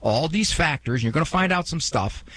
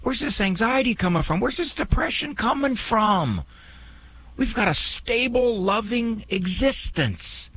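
bewildered parents; 10 semitones higher than usual. Retold or imitated. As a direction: voice baffled parents, pitched well up. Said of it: "when parents look at their child and say".

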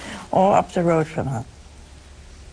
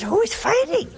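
mock puzzled question; 14 semitones higher than usual. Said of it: "I said".

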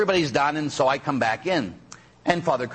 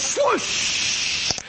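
long airy whoosh; hammed-up rushing wind noise; imitated swoosh. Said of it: "And he goes".

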